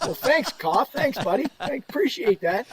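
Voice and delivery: high-pitched mock gratitude; sarcastic thanks. Player to teammate who slighted him, sarcastic. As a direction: thank him as if he did you no favor.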